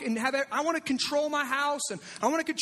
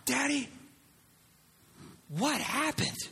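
put-on three-year-old daughter; 3 dB quieter, slower than usual. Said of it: "She came downstairs".

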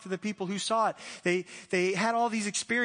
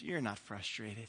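quiet firm pastor; 11 semitones lower, 13 dB quieter, slower than usual. Retold or imitated. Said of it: "He goes".

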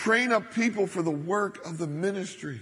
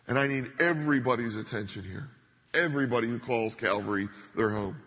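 normal talking voice

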